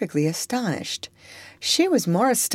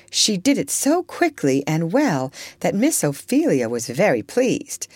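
plain reading voice

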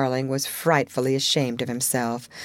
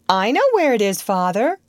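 put-on higher, louder, helpful child's call; eager cry; 6 semitones higher, 4 dB louder than usual. A narrator's reading. Wendy always glad to be of service, eager cry. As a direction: pipe up eagerly to help. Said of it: "Wendy cried".

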